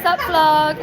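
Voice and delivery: loud hype vlog intro; excited greeting. Real, not acted. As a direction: call out to camera.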